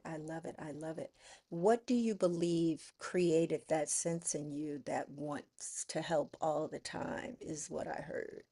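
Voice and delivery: usual speaking voice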